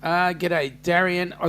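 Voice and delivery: ordinary talk, nothing marked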